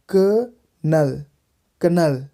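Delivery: "क-नल" split slowly, then "कनल" joined; short "क", pause, then "नल"; syllable by syllable. Shown as a demonstration. Teacher showing learners the method, "क-नल" broken up slowly. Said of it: "तरीके से बोलना है".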